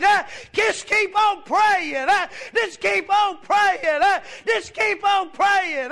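loud, high-pitched revival preaching; full-force preaching. Real, not acted. Preacher urging the congregation to keep praying, full-force shouted preaching.

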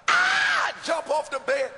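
sudden high, loud yelp of pain; a boy yelping as the belt lands; mock yelp from a whipping.

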